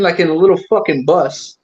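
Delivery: normal talking voice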